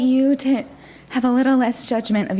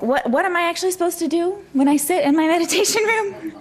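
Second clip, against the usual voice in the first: higher-pitched, mock-exasperated question, laughing at the end; acted bafflement; 8 semitones higher, faster than usual. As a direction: play up being lost, pitch climbing. Acted baffled question to meditation teacher.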